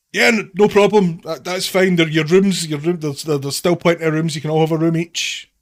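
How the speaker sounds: high, loud stammering innkeeper; flustered innkeeper. Acted. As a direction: babble it out all flustered.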